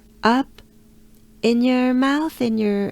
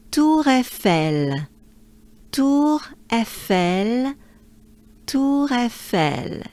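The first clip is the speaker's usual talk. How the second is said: slow, each sound pulled apart; slow Tour Eiffel; 4 semitones higher, slower than usual. Shown as a demonstration. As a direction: Tour Eiffel stretched out, separate syllables. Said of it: "So I would say".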